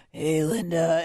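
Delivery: Scott Shannon. Retold or imitated. Producer mimics radio friend answering her call, lightly imitated announcer voice. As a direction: draw out the greeting like a radio legend. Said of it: "his voice is unbelievable".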